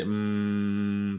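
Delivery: long level "mmm"; sustained humming m sound; closed-lip m hum. Shown as a demonstration. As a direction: press the lips together and hum. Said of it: "唇をしっかり上と下当てて".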